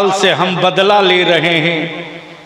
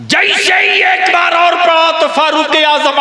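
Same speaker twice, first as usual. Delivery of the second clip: high, loud impassioned storytelling; preaching at full force; 3 dB louder than usual. Acted. Preacher thundering to the gathering, impassioned. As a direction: drive the story forward to the crowd.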